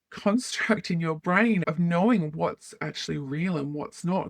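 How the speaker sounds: ordinary delivery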